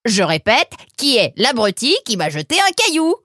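loud, very high, punched words; angry repeated demand. Acted. Fox getting no answer about the pebble, furious shout. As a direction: repeat it furiously, hammering each word.

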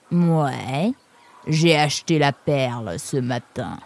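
put-on lumbering character voice; reply as a big-pawed oaf; Jean Mufle. Réplique de Jean Mufle, voix composée. (voix composée de gros lourdaud)

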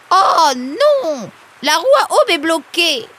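high, loud cry of dismay; dismayed exclamation. Acted. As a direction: cry out at a sudden disaster. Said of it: "s'exclama Anémone".

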